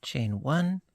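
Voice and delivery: ordinary delivery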